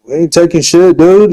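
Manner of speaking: tough-guy bluster, lightly put on; mocking Boger. Ranter mocking Boger's tough monologue to the party. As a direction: puff it up like a fake hard man. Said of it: "started going, like, monologuing".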